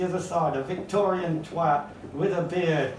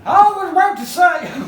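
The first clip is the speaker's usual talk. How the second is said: high, loud, hammed-up orator; Charles Dickens; 12 semitones higher, 10 dB louder than usual. Author reluctantly doing the Dickens impression, high hammed-up oratory. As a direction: launch into a loud, comic impression of a ranting speaker. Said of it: "do the Charles Dickens impression".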